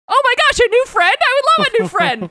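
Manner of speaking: very high, loud, gushing squeal; overjoyed axe. Retold or imitated. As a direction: gush like an eager talking weapon. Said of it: "but the axe is like".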